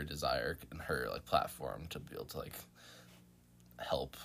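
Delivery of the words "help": normal talking voice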